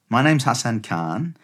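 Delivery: normal talking voice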